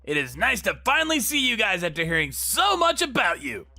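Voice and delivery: showy greeting. Acted. Brother turns up at the factory out of the blue, showy greeting. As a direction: greet the whole room with gusto.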